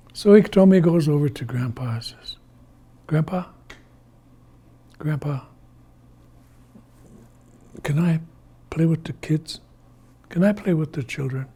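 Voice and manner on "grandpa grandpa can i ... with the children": put-on soft, hesitant child-like request; quiet, tentative plea; timid asking. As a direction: ask Grandpa gingerly, in small pieces. Iktomi timidly asks Grandpa to play.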